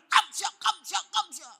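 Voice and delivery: high, explosive shouted KAMSIA; furious mimicking. Elder grows angrier at the pastor's thanks, high furious shout. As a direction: yell KAMSIA in an acted rage. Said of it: "Dia tambah marah".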